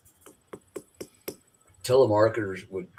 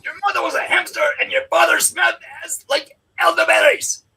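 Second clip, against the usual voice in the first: taunting Frenchman; 4 dB louder than usual. Acted. Quoting Monty Python taunter.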